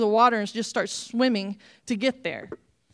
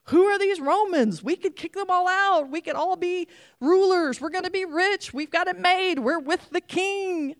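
much higher, louder boasting hopes; excited disciples imagining glory; eager dreaming; 9 semitones higher, 3 dB louder than usual. Acted. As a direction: dream big like a zealot. Disciples' dashed dreams voiced.

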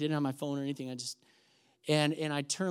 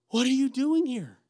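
higher, astonished greeting; 8 semitones higher, 4 dB louder than usual. Retold or imitated. Meeting a former church member at a hotel, astonished. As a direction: exclaim it in acted surprise, pitch up. Retold surprise at meeting her.

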